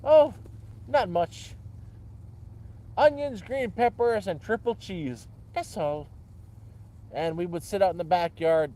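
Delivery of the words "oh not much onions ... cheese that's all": Irish grandfather voice, jumps higher at first; Irish accent. Retold or imitated. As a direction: voice grandpa's Irish brogue, cheerful. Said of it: "he had a very thick Irish accent".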